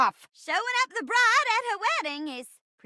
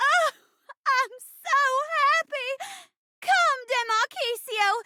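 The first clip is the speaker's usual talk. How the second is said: overjoyed bride greeting a missing groom; hammy romantic heroine, relieved and swooning; melodramatic stage bride; 2 semitones higher than usual. Acted. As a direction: play it as a theatrical, lovestruck bride. Plays the bride in a play.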